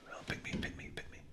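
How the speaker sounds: whispered pleading; man in the audience, desperate to be chosen, whispering; eager whisper. Retold or imitated. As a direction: hiss it eagerly under your breath. Man begging to be picked.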